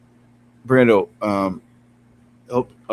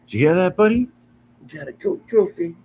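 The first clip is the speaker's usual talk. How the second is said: much higher, sing-song voice to the cat; 8 semitones higher than usual. Real, not acted. Streamer tells his cat about the gift, high and excited.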